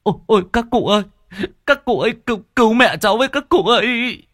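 high, loud, fast desperate wail for help; a frantic son wailing to the old men for help; panicked wailing. Acted. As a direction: cry out for rescue in terror. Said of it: "tiếng khóc thất thanh của chú Rêu chạy vào sân mếu máo".

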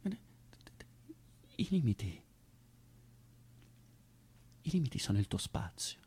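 very slow breathy whisper. Acted. Actor to Zoe after the outburst, barely whispered. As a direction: drop to nothing after the storm.

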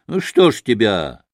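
ordinary delivery

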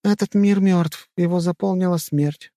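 high-pitched female character voice; high, measured girl's voice; solemn sister. A narrator's reading. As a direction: intone it as a grave recollection. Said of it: "произнесла Мона, первая сестра".

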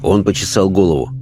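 plain reading voice

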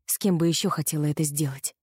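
ordinary delivery, nothing marked

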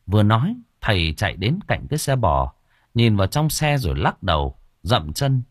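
ordinary delivery, nothing marked

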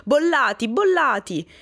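high, loud exasperated shout; loud cry. A narrator's reading. Professor explodes over his pupil's mistake, crying out loudly. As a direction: cry out the pupil's name in outrage. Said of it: "dette un grido altissimo".

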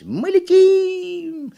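high, loud, very drawn-out cry; elated squealing cheer; yelling monkey. A narrator's reading. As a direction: shriek in delight as a monkey. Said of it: "закричала «Почешись»".

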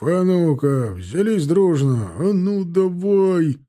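slow, drawn-out heave-ho call; barking. A narrator's reading. Soldier rallying men to lift a cart, barking. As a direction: get them all heaving together. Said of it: "рявкнул".

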